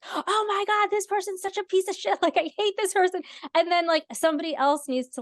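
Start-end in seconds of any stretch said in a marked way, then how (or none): 0.0-3.2 high, exasperated fuming; imitating her own furious reaction; mock outrage. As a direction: act out the angry first read, pitched up. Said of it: "my initial reaction was like".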